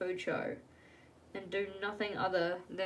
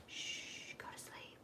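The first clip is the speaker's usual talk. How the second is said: hushing whisper to the book; 15 dB quieter, slower than usual. Pretend. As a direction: shush it softly like a sleepy child. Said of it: "And I'm just like".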